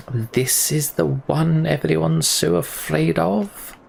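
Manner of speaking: high, slow, sneering thug voice; a scornful fighter sizing up the hero; sneering tattooed man. Acted. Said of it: "sneers a man with a face tattoo".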